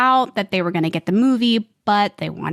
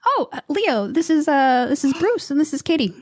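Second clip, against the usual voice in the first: higher-pitched, hesitant introduction; Steven Spielberg; 7 semitones higher than usual. Retold or imitated. Guest imitating the director introducing her to a star, high and halting. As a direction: act out a man making halting introductions, pitched up. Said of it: "and he goes".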